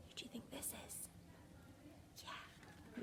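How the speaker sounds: fast secret whisper; conspiratorial whisper to the class. Real, not acted. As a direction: lean in and whisper the guess. Whispered question to children.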